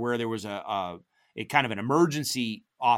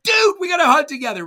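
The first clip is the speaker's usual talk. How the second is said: high, loud eager shout; mock yelling to a buddy; excited shout; 10 dB louder, faster than usual. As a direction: shout the plan with glee. Retold shouted plan.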